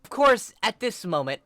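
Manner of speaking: ordinary delivery, nothing marked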